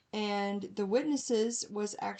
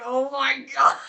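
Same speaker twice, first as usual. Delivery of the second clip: exasperated quote; 4 semitones higher, 11 dB louder than usual. Retold or imitated. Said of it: "It's like".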